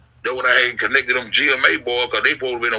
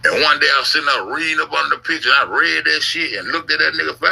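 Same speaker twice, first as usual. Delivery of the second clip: ordinary delivery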